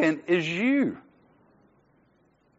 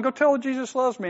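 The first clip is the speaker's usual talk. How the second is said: the same instruction pushed higher and more insistent; insistent repeat; 8 semitones higher than usual. Retold or imitated. The Lord repeating himself to a stalling preacher. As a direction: press it, a little impatient now. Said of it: "he's starting to get a little".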